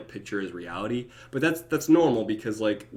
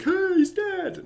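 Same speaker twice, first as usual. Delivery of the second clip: much higher, slow chirpy announcement; cheery mock celebration; 3 dB louder, slower than usual. Mourner cheerfully announcing a death, high chirpy. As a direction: sing it out brightly, wrongly cheerful. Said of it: "where you're like".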